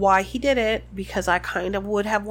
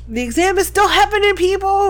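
mock proctor shout; 9 semitones higher, 8 dB louder than usual. Joking exam proctor shout.

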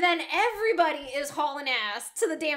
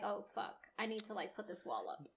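hushed realization; 5 semitones lower, 13 dB quieter than usual. Retold or imitated. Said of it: "he was like".

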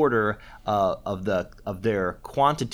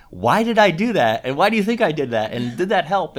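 higher, louder quizzing; teacherly questions to class; 9 semitones higher, 9 dB louder than usual. Acted. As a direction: ask it aloud as if prompting a class.

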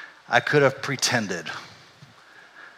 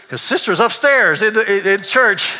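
high, loud mock bewilderment; puzzled churchgoer; 8 semitones higher, 7 dB louder than usual. Churchgoer catching the pastor in a lie, loudly puzzled.

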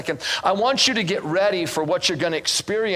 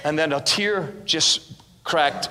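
ordinary talk

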